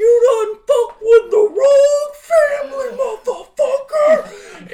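high, very loud screamed threat, hammed up; imitated furious shout. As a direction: scream it like a wild attacker charging in. Acting out friend's threat while attacking.

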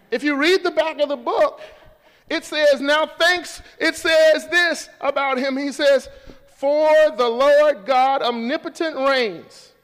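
loud, high preaching voice; preaching at full force. Real, not acted. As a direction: build the sermon to a ringing pitch.

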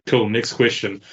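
normal talking voice

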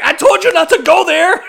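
high, loud yell of get out; shouted get out. Retold or imitated. Retelling the movie scene, a high loud shout. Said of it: "The dude who was like".

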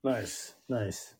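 low, very soft dry nice; a muttered sarcastic nice; deadpan sarcasm. Real, not acted. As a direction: undercut it dryly.

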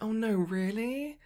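high, slow, imitated disappointment. Star hearing her shoes are missing, high slow imitation. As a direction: mimic a star's sweet dismay. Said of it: "she was like".

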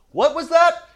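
high, loud squeaky "what was that?"; squeaky game-show prompt; mock cupped-ear prompt. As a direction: prod the crowd to answer, hammed up. Mock call for audience answer.

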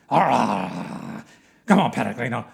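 loud, aggressive guard-dog shouts; barking rabid dog. Acted. Dog warning off a passing pilgrim, loud barking taunts. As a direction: bark the words like a dog behind a fence. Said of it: "rabid dogs behind large fences barking".

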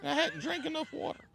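high strained falsetto voice; cracking high pretend voice. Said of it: "my voice is cracking up".

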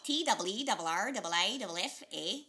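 put-on loud Canadian goose accent. Doing the goose's lines from the children's theater play in a Canadian accent. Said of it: "make him Canadian".

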